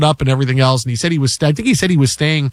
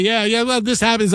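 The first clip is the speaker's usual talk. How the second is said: cocksure; 5 semitones higher than usual. Retold or imitated.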